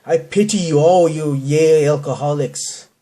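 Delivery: ordinary delivery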